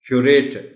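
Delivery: Curator with strong middle syllable; stress on R. Shown as a demonstration. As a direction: punch RE in curator. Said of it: "आर पर stress है".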